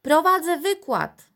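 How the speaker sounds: high, loud reply across rooms; shouting back diplomatically. A narrator's reading. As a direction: call back to grandma, diplomatically. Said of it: "odkrzyknął dyplomatycznie Dominik".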